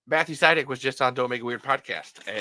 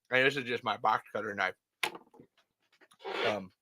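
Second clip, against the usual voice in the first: ordinary delivery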